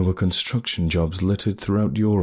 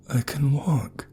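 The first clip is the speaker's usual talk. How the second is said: awed whisper; 8 semitones higher, slower than usual. Acted. Narrator to the huntress, first steps, awed whisper. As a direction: whisper it in disbelief. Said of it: "I said".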